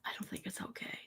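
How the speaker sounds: a hushed, unvoiced repeat; a whispered aside in disbelief. Real, not acted. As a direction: whisper it to yourself, appalled. Repeated line, now whispered.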